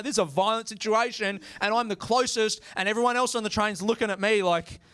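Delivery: ordinary talk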